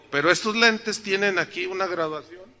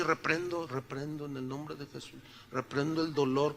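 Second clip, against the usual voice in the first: mock prayer; 5 semitones lower, 7 dB quieter than usual. Mock prayer rebuking his pain.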